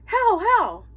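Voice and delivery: high, loud urgent call; urgent countering command. A narrator's reading. Girl shouting the dogs back left, urgent. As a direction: snap the team back the other way. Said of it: "I countered".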